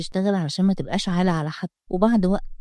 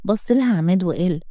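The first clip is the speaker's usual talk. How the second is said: plain reading voice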